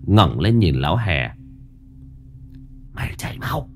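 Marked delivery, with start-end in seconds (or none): 2.9-3.7 high, soft threatening ghost voice; menacing ghost. A narrator's reading. Ghost woman threatens the old man, high and menacing. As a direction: hiss the warning like a ghost. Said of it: "đanh mặt lại".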